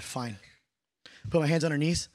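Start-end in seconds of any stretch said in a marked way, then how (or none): 0.0-0.4 low, quiet, reluctant reply; grudging consent. Retold or imitated. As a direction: imitate someone giving in unwillingly. Lady reluctantly allows one more prayer.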